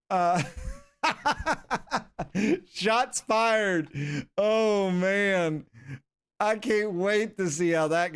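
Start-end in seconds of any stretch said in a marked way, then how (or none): 1.0-2.7 high burst of laughter. Real, not acted. Laughing at his manager's jab at Cooper. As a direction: crack up at the answer. Reacts to harsh press answer.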